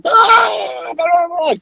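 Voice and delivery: high, loud cursing shout; screaming Italian grandma scolding guests; mock Italian grandma tirade. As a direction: rant like a furious Italian nonna. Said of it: "my grandma would lose her shit, like, in Italian".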